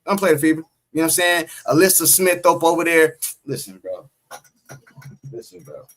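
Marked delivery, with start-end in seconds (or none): 3.5-4.1 low, much softer, muttered to yourself; low aside. Real, not acted. 5.3-5.8 a breathy whisper with no voice. Real, not acted. Repeats the warning under breath.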